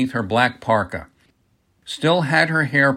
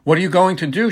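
higher-pitched anxious question; fearful quaver; 6 semitones higher, 4 dB louder, faster than usual. A narrator's reading. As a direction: ask it in mild fear, pitch raised. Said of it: "a fearful quaver in her voice".

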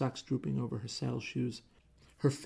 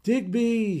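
loud high wail across the theater; husband wailing for his rival from the stage; wailing yell; 10 semitones higher, 10 dB louder than usual. Acted. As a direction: bellow his name from the stage. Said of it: "he wailed".

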